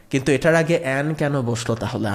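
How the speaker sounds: normal talking voice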